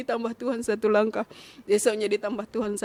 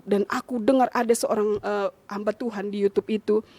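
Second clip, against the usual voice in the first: ordinary delivery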